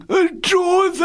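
very high, loud cry to the master; great cry. A narrator's reading. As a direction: cry out as if suddenly jolted awake. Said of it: "大叫一声". (猛然高声大叫)

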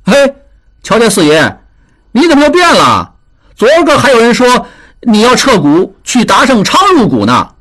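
音调高扬的挖苦调侃; 拿他的反复来打趣. A narrator's reading. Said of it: "一个看热闹的在旁边调笑道". (看热闹的人拿达庆打趣，高声调侃)

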